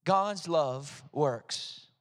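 drawn-out words, leading others to join in; measured, word-by-word call to recite; slow group recital. Shown as a demonstration. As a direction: say it very slowly for everyone to repeat. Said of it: "Did you say it with me?".

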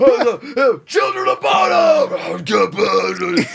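high, loud mock heckler shout with a laugh; hammed-up angry fan; mock crowd yelling. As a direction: yell the band name at the stage.

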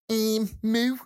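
cow mooing high in a Liverpool accent. Shown as a demonstration. Podcaster guessing how Liverpool cows sound, Scouse-accented moo. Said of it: "in Liverpool".

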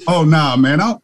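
high, feigned ignorance; playing it off. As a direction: pretend you know nothing, high. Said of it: "try to play it off".

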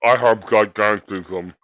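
lumber through it like a huge wrestler; Andre the Giant. Pretend. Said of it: "It's called Andre the Giant".

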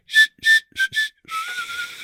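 high drawn-out shush. Pretend. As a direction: shush them slowly. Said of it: "I'm just gonna go".

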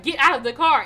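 loud bystander's warning, re-enacted; urgent shout. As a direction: call out a warning to someone in danger.

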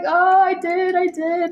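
sheepish. Retold or imitated. Sheepish admission to mom.

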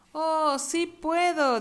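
high, slow puppy voice; drawn-out cartoon pup. Voz de Rocky. (voz lenta de cachorrito)

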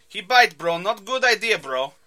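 put-on loud, high Russian-thug accent, bro; tracksuit thug accent. Podcast host voicing the Russian thug threatening Clint, loud Russian accent. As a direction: bark it like an Eastern European tough guy.

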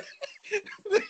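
high wheezing laugh; high-pitched helpless laugh at the story; high laughing fit. Real, not acted. Laughing at the retold gag.

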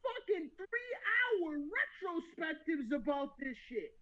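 high, cracking laugh-cry of outrage; incredulous laughing rant; laughing disbelief. Real, not acted.

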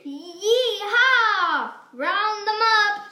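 loud cowboy yeehaw; cowboy whoop. Pretend. Whale rounding up fish.

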